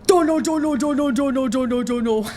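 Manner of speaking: panicked chant that winds down; nervous, repeating himself; frantic babble. Acted.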